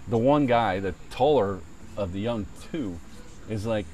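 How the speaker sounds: usual speaking voice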